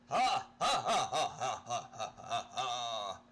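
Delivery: loud, high villain cackle; a hammed-up mad laugh; evil laugh. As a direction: throw back a mocking cackle. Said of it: "Oh, horrible laugh".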